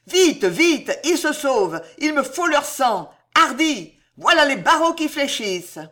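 high, loud, bloodthirsty shouts; frenzied urging. A narrator's reading. Ruggieri urging soldiers breaking the window bars, shouting frantically. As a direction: spur them on at full voice.

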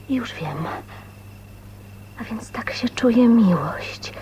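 slow, hushed wondering whisper. Acted. Woman realising what love feels like, slow whisper. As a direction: breathe the words out in slow wonder.

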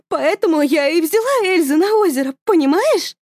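high, loud, frantic pleading; mother justifying herself to husband, frantic; manic mother. Acted. (высоко и громко, с надрывом)

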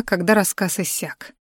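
plain reading voice

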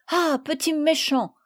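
a hint of a cross girl; scolding cry. Madeleine scolding the pecking bird, mildly cross. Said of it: "s'écria Madeleine".

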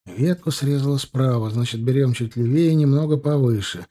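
usual speaking voice

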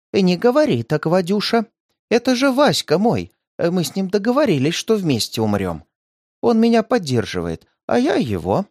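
high, tender voice of a lonely granny; old woman voice. A narrator's reading. The old woman answers Vadik.

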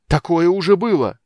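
loud, high cry of realisation; exclaim, struck by a dreadful guess; stricken exclamation. A narrator's reading. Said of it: "вдруг воскликнул поражённый страшной догадкой". (потрясённый громкий возглас)

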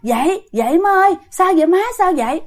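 high, alarmed acted calls; urgent calling. Daughter waking her mother from a nightmare, urgent calling. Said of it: "mới kêu".

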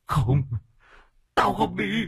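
rising high, building to a shout; refuse, climbing to a scream; shrieking ghost. A narrator's reading. Ghost shrieks refusal as it is dragged away, rising high. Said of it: "mà rú lên".

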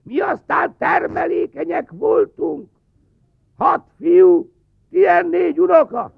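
put-on high, shrill-pitched old lady voice; cackling old woman. Old aunt answers the official, cackling high old-lady voice. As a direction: squawk like a proud granny. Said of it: "Náncsi néni csak tovább kárált".

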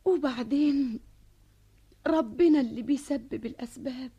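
ordinary talk, nothing marked

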